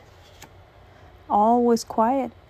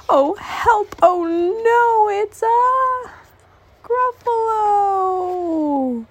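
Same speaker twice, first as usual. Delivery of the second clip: high, frantic cries, stretching Gruffalo out; fluttering cries for help; 13 semitones higher, 4 dB louder, much slower than usual. Acted. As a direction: panic and wail the name long. Mouse meets the Gruffalo.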